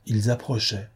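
ordinary delivery

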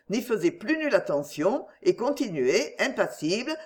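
plain reading voice

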